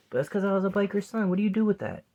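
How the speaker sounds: loud, high, insistent outburst; heated defence. Real, not acted. Biker's son defends his upbringing, loud and high. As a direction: raise your voice, defending where you come from.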